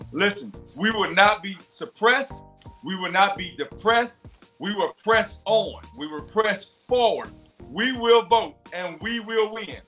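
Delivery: rising rally chant, building; rally speech. Real, not acted. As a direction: preach it to the crowd. Rallying cry to voters.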